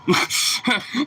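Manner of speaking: sudden high burst of laughter. Real, not acted. Telling the story of the lost dog, bursting into high laughter. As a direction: laugh out high at how silly it ended.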